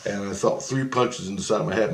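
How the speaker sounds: ordinary delivery, nothing marked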